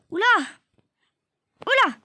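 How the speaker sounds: sharp high loud exclamation; startled oula. Real, not acted. Gamer startled by a game glitch, sharp high yelp.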